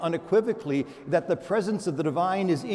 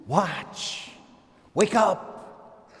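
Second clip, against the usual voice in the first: rising, pressing call to wake up; urgent command; 3 semitones higher, much slower than usual. Acted. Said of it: "therefore I say to you".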